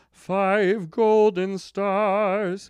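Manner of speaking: announcing the rating with slow weight; five golden stars, drawn out. Real, not acted. Emphatic rating, slow and stretched.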